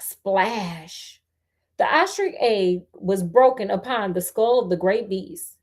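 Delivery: plain reading voice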